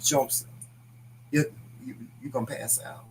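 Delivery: hushed plea. Retold or imitated. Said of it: "I'm like".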